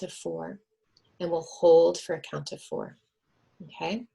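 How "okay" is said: ordinary delivery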